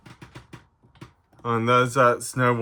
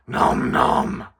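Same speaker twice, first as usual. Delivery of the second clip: munching creature voice; 13 semitones lower than usual. Acted. Puppet Stripe answers.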